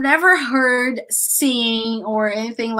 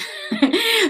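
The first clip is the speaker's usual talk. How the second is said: high laugh; 11 semitones higher than usual. Real, not acted. Laughs at own joke.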